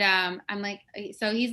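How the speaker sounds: ordinary talk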